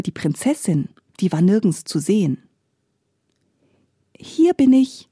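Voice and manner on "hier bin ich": higher, little princess voice, not hushed; lightly voiced small voice. A narrator's reading. Hidden princess answers from the sack in a small voice. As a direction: pipe up in a small high voice. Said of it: "eine leise Stimme".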